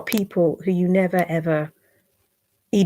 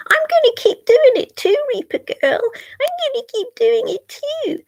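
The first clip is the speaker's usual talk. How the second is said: much higher, mocking sing-song imitation of a troll; mocking whiny troll. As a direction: parody them in a high, taunting voice.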